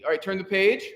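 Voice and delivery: usual speaking voice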